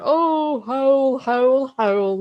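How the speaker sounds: high theatrical wail, easing down at the end; hammed-up grief, pitched high; mock bereft. Imitating general mourning his fiancee, mock bereft. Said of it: "acting all bereft and".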